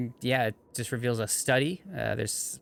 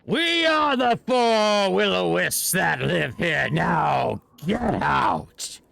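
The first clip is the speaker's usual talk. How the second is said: spooky spirit voice; 6 semitones higher, 4 dB louder, much slower than usual. Acted. Will-o-wisps threaten party.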